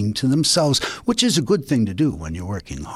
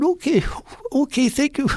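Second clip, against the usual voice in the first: much higher, bewildered thanks; high upset rabbit voice; 13 semitones higher than usual. Acted. Confused Rabbit thanking Coyote for the scissors. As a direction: thank him while confused and hurt.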